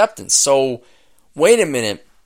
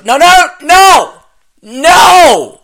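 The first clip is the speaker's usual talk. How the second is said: rising, much higher and louder protest; 12 dB louder, much slower than usual. Real, not acted. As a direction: shout down the bad news in disbelief. Reacting in disbelief to the story.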